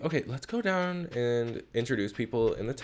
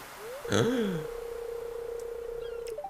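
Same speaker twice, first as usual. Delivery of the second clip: mock gasp; 12 semitones higher, 4 dB quieter than usual. Reacting to rude game dialogue.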